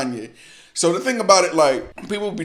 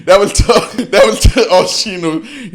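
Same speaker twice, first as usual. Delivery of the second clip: laughing; 8 semitones higher, 11 dB louder than usual. Real, not acted. Cracking up at the diss.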